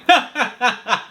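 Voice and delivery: high put-on laugh; demo laugh. Shown as a demonstration. Coach demonstrates laughing in different ways. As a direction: fake a laugh until it turns real. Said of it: "just laugh in a lot of different ways".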